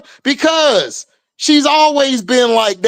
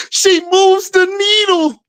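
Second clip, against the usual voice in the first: slow, higher sarcastic drawl; mocking catchphrase; 7 semitones higher than usual. Mocking a fan slogan, slow and high. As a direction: sneer at the hype slowly. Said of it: "mocking the catchphrase again".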